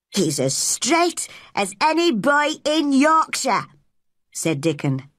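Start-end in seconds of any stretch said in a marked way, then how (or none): 0.1-3.7 put-on high-pitched, emphatic boy's voice; proud country boy. A narrator's reading. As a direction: declare it proudly for your friend. Friend proudly defends the boy.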